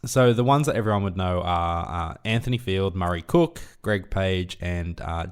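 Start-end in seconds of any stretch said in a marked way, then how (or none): none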